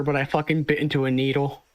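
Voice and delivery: usual speaking voice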